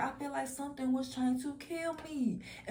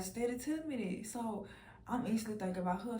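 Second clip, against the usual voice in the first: normal talking voice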